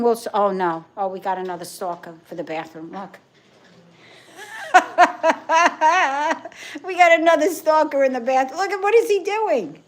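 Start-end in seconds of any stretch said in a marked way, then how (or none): 4.4-6.8 high delighted laugh; a real amused laugh; burst of laughter. Real, not acted. Cat stalking in the bathroom. 6.8-9.8 high, louder amused outburst; laughing delight at the cat; excited, laughing. Real, not acted. Cat stalking in the bathroom.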